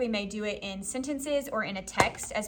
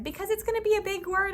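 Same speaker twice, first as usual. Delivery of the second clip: high, eager class reply; pupils' chorus answer; 9 semitones higher, faster than usual. Retold or imitated. Pupils answering teacher's question, high chorus voice.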